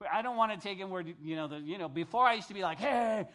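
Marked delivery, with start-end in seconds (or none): 2.8-3.3 higher, louder party greeting; partying call. Retold or imitated. His old self heading out, louder party holler.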